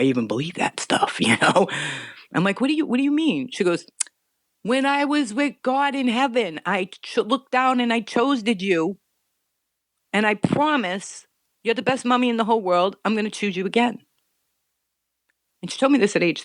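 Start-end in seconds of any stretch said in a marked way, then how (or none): 2.6-3.5 surprised. Retold or imitated. Mother's shocked reply. 4.6-14.0 put-on earnest child voice.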